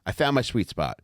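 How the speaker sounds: ordinary talk, nothing marked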